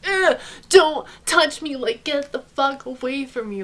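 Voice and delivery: a slow, high, grossed-out ugh pushing him away; disgusted ugh. Retold or imitated. Body recoils from his touch.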